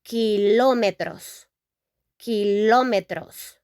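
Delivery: kilómetros stressed on ló, final s clear; teacher modelling kilómetros; Spanish pronunciation. Shown as a demonstration. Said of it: "we can say".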